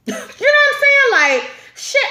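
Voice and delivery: high, slow words through laughter; laughing squeal. Real, not acted. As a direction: crack up at your own joke. Laughing at her own joke.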